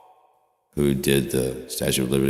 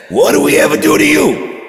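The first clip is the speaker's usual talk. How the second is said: very high, loud scandalized son; hammed-up shaming of mom, cracking into shrieks; mock outraged shout; 11 dB louder than usual.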